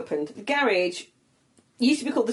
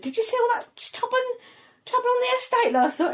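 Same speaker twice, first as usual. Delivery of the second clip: higher imitation of a chatty cashier; gossiping till woman. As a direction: play the nosy neighbour at the till. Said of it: "She went".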